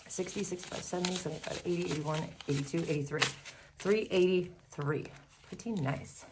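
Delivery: winded, short of breath. Real, not acted. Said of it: "If I sound out of breath".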